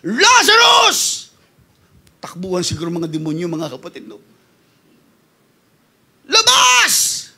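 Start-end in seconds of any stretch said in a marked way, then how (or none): 0.0-1.3 high, slow, loud call; Jesus calling out. Acted. Jesus calls Lazarus out of the tomb, loud and high. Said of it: "Yun yung boses na narinig niya". 6.2-7.3 high ringing command; commanding call. Acted. Jesus orders Lazarus out, high commanding call. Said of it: "Yun yung boses na narinig niya".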